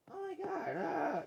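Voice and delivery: high, wailing mimicked shriek; hammed-up high wail; mock cry of alarm. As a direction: imitate someone crying out when hit back. Mimics the other's reaction to blast.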